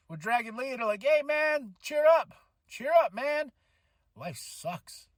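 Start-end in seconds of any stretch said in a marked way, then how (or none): 1.0-3.5 high, coaxing pep talk; acted cheering-up. Acts team cheering up Dragon Lee. 4.2-5.1 soft, low deadpan punchline; mock gloom. Joking gloomy punchline after cheering.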